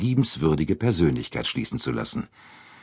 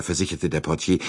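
ordinary delivery, nothing marked

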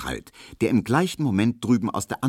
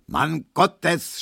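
laut und höher geschrien; schreiend; 10 semitones higher, 4 dB louder than usual. A narrator's reading. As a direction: schrei ungeduldig. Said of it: "schrie der". (Markowski schreit den Barbier an, lauter)

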